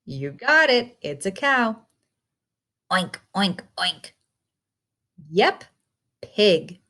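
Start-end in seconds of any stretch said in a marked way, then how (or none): 2.9-4.1 playful mock pig grunts; lightly voiced pig noises; pig oinking. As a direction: oink like a pig for the kids. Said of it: "Talk like an animal".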